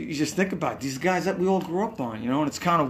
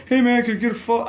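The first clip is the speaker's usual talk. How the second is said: high, loud, quick mock request; eager fan; 10 semitones higher, 6 dB louder, faster than usual. Fan rushing into the star's dressing room, mock eager. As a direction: gush it like a pushy fan. Acting out pestering a star backstage.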